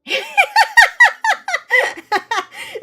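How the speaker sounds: a burst of shrieking laughter; loud high laugh. Real, not acted. Reacting to the uwu voice idea.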